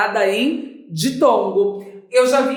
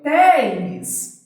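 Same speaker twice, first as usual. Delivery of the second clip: "téis" long and higher in pitch; single out "téis", stretched as the strong syllable; stressed syllable isolated; 4 semitones higher than usual. Shown as a demonstration. Teacher answering which syllable is strongest, "téis" lengthened and stressed. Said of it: "Qual é a sílaba mais forte?".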